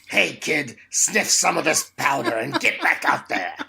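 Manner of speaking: laughing tough guy villain voice; lightly voiced wiseguy, half laughing; Danny DeVito. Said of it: "That is Danny DeVito".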